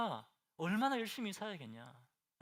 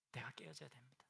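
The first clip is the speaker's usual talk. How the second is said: barely audible, much softer whisper; fainter whisper; 4 semitones lower, 14 dB quieter than usual. Shown as a demonstration. Preacher repeating the point to the congregation, in a fading whisper. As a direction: repeat it as a near-silent breath. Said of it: "whispered again, much softer".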